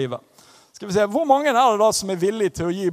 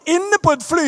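ordinary talk, nothing marked

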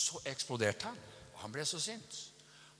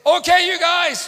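very high, loud, drawn-out yell; furious shout; 14 semitones higher, 20 dB louder, slower than usual. Retold or imitated. Car rental man out of control with anger, furious shout. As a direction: explode at them at full volume. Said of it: "han var så sint".

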